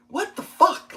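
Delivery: high, startled what the fuck; shocked outburst. Real, not acted. Finding the stripped bolts, a high shocked what the fuck. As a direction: blurt it out in disbelief.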